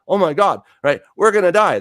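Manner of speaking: hammed-up panic, loud and high; panicked cry. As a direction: play the frightened disciples shouting in the storm. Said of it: "they're all freaking out".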